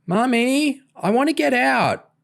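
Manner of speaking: put-on high whining child voice; whiny little girl. A narrator's reading. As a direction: whine it like a small girl. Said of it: "Charlotte whined".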